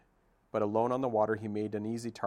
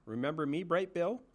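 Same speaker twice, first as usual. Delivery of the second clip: high, teasing goose voice; old goose voice; 8 semitones higher than usual. Acted. Goose asking the gosling if he remembers her, fond. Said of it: "said Loudwing".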